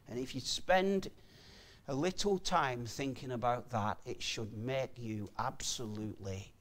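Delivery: low, soft pulpit warning; hushed, grave preaching. Real, not acted. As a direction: drop the voice and let it sink in.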